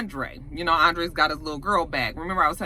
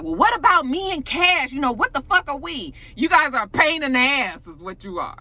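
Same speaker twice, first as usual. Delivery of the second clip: mildly indignant, higher-pitched complaint; 7 semitones higher than usual. Retold or imitated. Retelling a woman's complaint to her man, indignant. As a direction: protest with some sass, pitch up.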